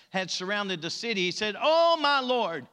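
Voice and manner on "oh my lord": panicked servant. Acted.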